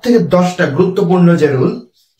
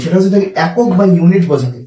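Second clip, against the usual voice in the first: ordinary delivery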